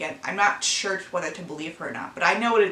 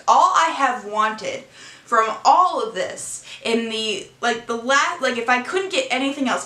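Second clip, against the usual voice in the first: rising, emotional plea, louder and higher; pleading; 4 semitones higher, 4 dB louder than usual. Real, not acted. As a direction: pour out the one thing you need. Pleading for restraining order.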